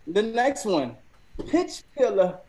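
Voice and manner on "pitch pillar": loud, higher British-accented announcement; attempted British accent. Shown as a demonstration. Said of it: "in a British accent".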